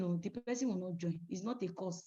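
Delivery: usual speaking voice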